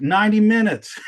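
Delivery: mock grand director; a hint of a proud director announcing run time; Bergman boast. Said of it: "he was like".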